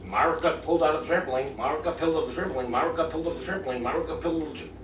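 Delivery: fast, repetitive mocking imitation; imitating repetitive rant. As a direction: mimic the same line over and over, rushed. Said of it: "the way you were talking about it".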